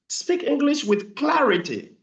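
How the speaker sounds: high, slow, spaced-out plea for clarity; emphatic exhortation. Retold or imitated. Coach urging show-off English learners to be clear, emphatic exhortation. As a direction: urge it slowly with the pitch pushed up.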